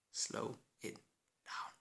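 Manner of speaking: slow, breathy whisper; hushed whisper. Acted. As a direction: whisper it slowly to calm him. Friend hushes him.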